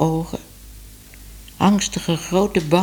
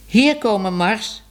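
calling a dog; 6 semitones higher than usual. A narrator's reading. Man calls his dog Mars home, calling out. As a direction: call the dog back home.

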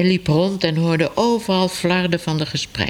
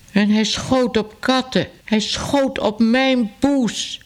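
higher, slow outraged lady; indignant lady; 8 semitones higher, slower than usual. A narrator's reading. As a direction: pour out your indignation about the cat, slowly. Narrator voices outraged wife. (verontwaardigde dame, hoog en traag)

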